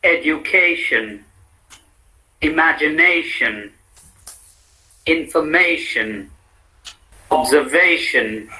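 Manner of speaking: "Education", "Occasion", "Situation" each stressed on the syllable before -tion. Shown as a demonstration. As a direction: read each model word for repeating, hitting the second-last syllable. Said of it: "primary accent on the last syllable but one".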